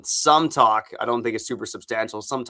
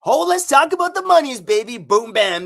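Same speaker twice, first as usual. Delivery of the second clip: much higher and louder, full hype-man energy; hyped ad intro; 14 semitones higher, 7 dB louder than usual. Acted. Podcast host launching the Rocket Money ad, hyped-up shout. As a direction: blast into the sponsor read like a hype man. Kicks off the sponsor read.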